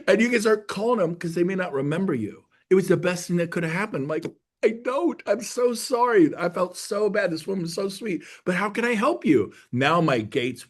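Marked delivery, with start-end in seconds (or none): 4.6-9.5 higher-pitched, contrite pleading; acted apology. Agent who forgot the caller, mock apologetic. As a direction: apologize profusely, voice lifted.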